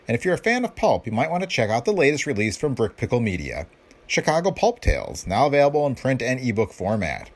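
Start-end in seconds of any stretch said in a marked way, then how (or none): none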